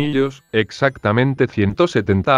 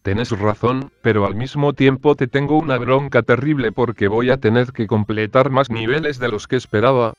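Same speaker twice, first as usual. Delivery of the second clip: usual speaking voice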